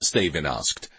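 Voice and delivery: ordinary delivery, nothing marked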